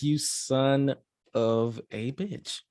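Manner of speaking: slow, stretched-out "You son of a bitch"; drawn-out admiring curse. Retold or imitated. To the cohost, a slow, drawn-out "You son of a bitch" in admiration. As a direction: drag every word of "You son of a bitch" out in awe. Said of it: "I'm like".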